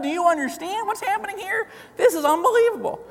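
high, thrilled disbelief; giddy dad can hardly contain it; excited. Retold or imitated. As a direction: push it up with wonder. Dad excitedly telling son.

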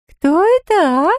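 very high, slow squeak; squeaky baby voice. A narrator's reading. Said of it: "пропищала Марни".

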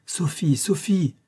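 calling her name, rising high; crying out. A narrator's reading. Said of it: "m'écriai-je de nouveau".

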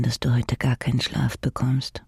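ordinary talk

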